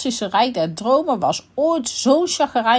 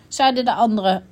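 ordinary delivery

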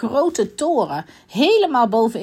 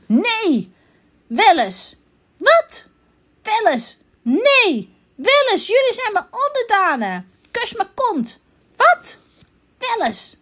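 high, punchy contradictions; bickering storybook characters, pitched up; acted squabble; 10 semitones higher, 3 dB louder than usual. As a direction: trade stubborn one-word retorts. Acted quarrel between the characters.

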